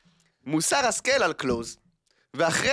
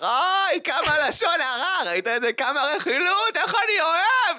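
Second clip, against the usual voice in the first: high, slow, gleeful shriek over juicy gossip; 12 semitones higher, slower than usual. Retold or imitated. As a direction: squeal with delight at the gossip, dragging the words. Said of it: "והוא צורח". (צעקה גבוהה ואיטית של הנאה מרכילות)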